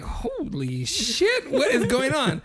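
retold disbelief, stretching the words; stunned reaction. Recalling overhearing the break-room row, stunned.

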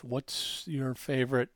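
normal talking voice

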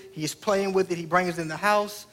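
ordinary delivery

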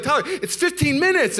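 high, exasperated wife voice; arguing wife imitation. Wife to Tyler over the delay, imitated high exasperation. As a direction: pitch it up and protest in exasperation. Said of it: "we're just yelling and talking very passionately".

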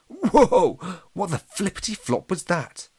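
high, loud startled yelp into a question. A narrator's reading. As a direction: cry out as something just misses you. Startled by a flying projectile.

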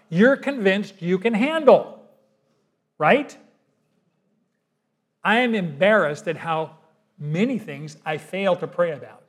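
normal talking voice

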